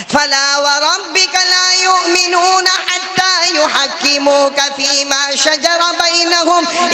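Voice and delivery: slow, high chanted recitation of the verse; Quranic recitation. Acted. Preacher reciting a Quran verse, slow high chant. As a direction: intone the Arabic slowly, melodic and high.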